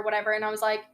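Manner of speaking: normal talking voice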